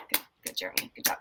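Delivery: breathy whispered fake praise; whispered sarcastic praise. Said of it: "And I'm just like".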